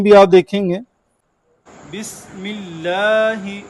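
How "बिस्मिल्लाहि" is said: slow, soft recitation with the seen in बिस्मिल्लाहि whistled. Shown as a demonstration. Teacher shows class the whistled seen in बिस्मिल्लाहि. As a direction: stretch बिस्मिल्लाहि slowly with a hissing seen. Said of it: "सीन जो है ये सीटी के साथ है".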